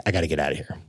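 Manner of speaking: low, soft private thought; inward muttering. Retold or imitated. Wanting to flee to California, hushed retold thought. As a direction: murmur it to yourself, pitch dropped.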